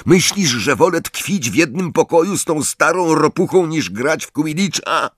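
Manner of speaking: high-pitched exasperated retort; restrained angry outburst; indignant shouting. A narrator's reading. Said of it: "ryknął za nią Harry".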